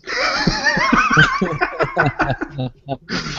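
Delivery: high-pitched burst of laughter; big laugh. Real, not acted. Delighted at own purchase.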